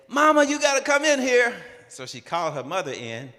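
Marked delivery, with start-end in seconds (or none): 0.1-1.5 high, loud call to mama in the next room. Retold or imitated. Surprised girlfriend calling her mother, high loud call. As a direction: yell for mama, startled.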